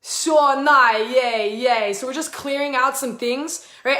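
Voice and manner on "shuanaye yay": sing-song invented syllables, drawn out and chanted; chanting in tongues; light language. Real, not acted. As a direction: channel flowing nonsense syllables like a chant. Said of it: "When I speak in light language".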